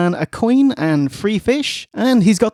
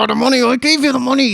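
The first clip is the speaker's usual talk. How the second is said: mocking greedy dwarves; 6 semitones higher, faster than usual. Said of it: "they're like".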